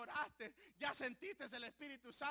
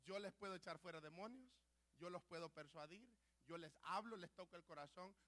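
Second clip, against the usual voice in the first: much softer, lower, hushed; God's soft voice; 4 semitones lower, 6 dB quieter than usual. Retold or imitated. God gently explaining to the preacher, hushed. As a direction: speak as God, gently and quietly. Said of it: "sentí que Él me dijo".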